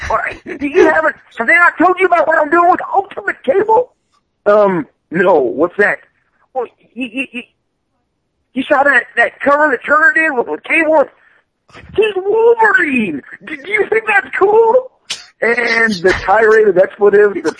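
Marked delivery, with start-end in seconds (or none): 0.1-3.9 high, excited voice of an eager comic writer; the writer bragging to a friend, high and eager; Robert Kirkman impression. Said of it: "my terrible imitation of Robert Kirkman". 6.5-14.9 high, excited voice of an eager comic writer; the writer bragging to a friend, high and eager; Robert Kirkman impression. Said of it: "my terrible imitation of Robert Kirkman".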